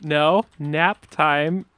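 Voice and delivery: drawn-out, word by word; slow note-taking. Pretend. Marking the caller down on a list, slow dictation. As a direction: spell it out slowly as if writing it down. Noting the call down slowly.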